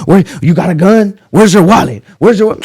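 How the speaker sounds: loud, high demanding shout; cop yelling. Retold or imitated. Police searching him after the shooting, loud high yelling. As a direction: bark the questions like a cop.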